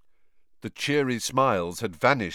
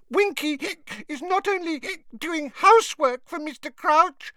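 very high, indignant hiccuping squeak; indignant squeaky elf; 4 dB louder than usual. A narrator's reading. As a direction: squeak it with drunk outrage. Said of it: "Winky squeaked indignantly".

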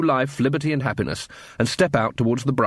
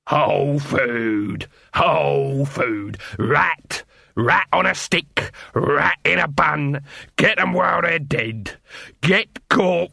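hawker's cry; 3 semitones higher, much slower than usual. Acted. Dibbler crying his wares.

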